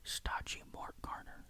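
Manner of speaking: ordinary delivery, nothing marked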